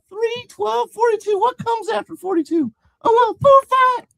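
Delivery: very high nonsense babble; addled falsetto, ending in a loud cry; LaFranc. Acted. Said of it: "As LaFranc began to make less sense".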